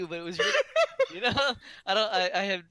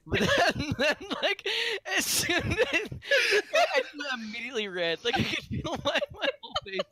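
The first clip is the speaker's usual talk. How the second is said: flustered giggling, higher; embarrassed laughing; 11 semitones higher than usual. Real, not acted. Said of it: "I'm getting red".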